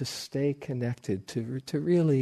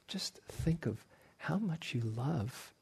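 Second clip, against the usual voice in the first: whisper; 2 semitones higher, 10 dB quieter than usual. Real, not acted. Teacher urging listeners inward.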